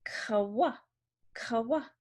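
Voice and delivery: "Kawa" as "kuh-wuh", K then W; two-part "kuh-wuh", lifting at the end; Q sound. Shown as a demonstration. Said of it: "it's the K and the W".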